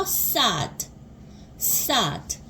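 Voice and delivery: "sad" said as sahd, with an open ah; open ah sound. Shown as a demonstration. Teacher changes the middle vowel for learners, "sad" said as sahd. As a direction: open the mouth wide for ah in "sad". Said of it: "아 벌린 입 모양, 아 소리 내서".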